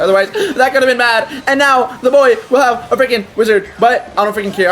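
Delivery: hammed-up gamer commentary; silly put-on voice. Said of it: "I don't know why I'm talking like that".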